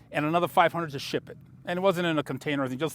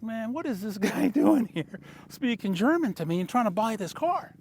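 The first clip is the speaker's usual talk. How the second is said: higher, amused voice of the baffled seller, with a laugh; amazed German seller; 7 semitones higher than usual. Retold or imitated. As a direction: play the puzzled car dealer, half laughing. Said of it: "He's like".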